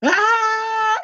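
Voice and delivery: very high mock shriek; imitated scream. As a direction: imitate her sudden, piercing scream. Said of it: "comenzó a gritar". (chillido agudo imitado)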